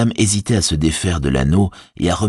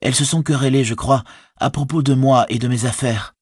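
higher-pitched hobbit recounting his ordeal; shaken Frodo; 8 semitones higher than usual. A narrator's reading. Captive hobbit telling his servant of the quarrel, higher and troubled. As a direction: tell it higher, as a frightened captive.

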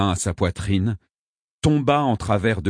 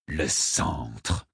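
low, soft disdain; understated scorn; deep disdain; 4 semitones lower, 6 dB quieter than usual. A narrator's reading. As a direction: say it with quiet contempt. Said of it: "avec un profond dédain".

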